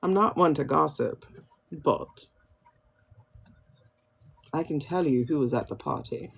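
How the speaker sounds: mock discreet British gossip; British gossip accent. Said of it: "British kind of way".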